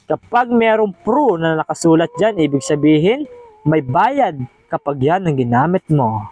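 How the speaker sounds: usual speaking voice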